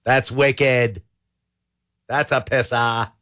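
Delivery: attempted Boston accent, dropped r. Host trying the slang out, in a Boston accent. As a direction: try a Bostonian's slangy verdict. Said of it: "say that in a Boston accent".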